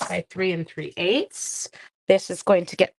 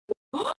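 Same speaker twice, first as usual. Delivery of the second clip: gasp; 14 semitones higher than usual. Real, not acted. Reacting to the embossed result.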